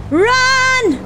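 high, drawn-out cry to flee; urgent call. Real, not acted. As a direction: call out high, urging escape. Urging the character to flee.

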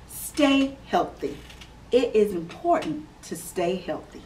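clear, measured read-aloud voice; gentle storybook reading for kids; reading to children. Acted. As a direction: read it out like story time for little ones. Reads picture book to children.

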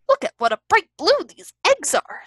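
high, bouncy storybook character voice; Tigger. A narrator's reading. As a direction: swoop up high with delight. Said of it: "said Tigger".

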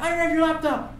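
hammed-up thief shouting back; mock yelled denial. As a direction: shout it back while fleeing.

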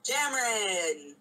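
high, loud, drawn-out call of Cameron; exclaiming. Acted. Character calls to a friend, exclaiming. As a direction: call out the name slowly. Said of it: "Hermione exclaimed".

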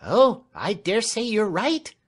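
high, loud voice for a cheerful servant woman; Clemency, good-humoured servant. Acted. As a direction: play an agreeable maid, high and drawn out.

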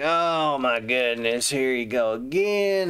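drawn-out, weary mock dismay; exasperated. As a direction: imitate a prophet fed up with the king. Acts Isaiah exasperated at the king.

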